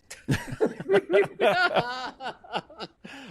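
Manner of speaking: long, high burst of laughter; big high laugh. Real, not acted. Guest recalls dropping the goose, laughing hard.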